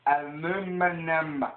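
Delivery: "Aluminum" very slow and loud, uh-LOO-mi-num; American pronunciation. Shown as a demonstration. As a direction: stretch out "Aluminum" in a high holler. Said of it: "Learn how to pronounce it".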